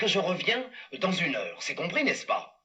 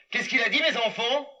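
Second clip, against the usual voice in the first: ordinary delivery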